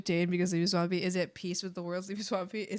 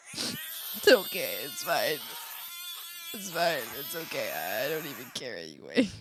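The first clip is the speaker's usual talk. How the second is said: mock hurt sulk; 5 semitones lower than usual. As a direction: pretend to be fine while wounded. Mock hurt pretending not to care.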